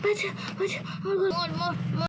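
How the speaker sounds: high, strained, gasping cries for help; choking plea. Acted. Woman being strangled by a witch, choking high pleading. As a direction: gasp out broken pleas.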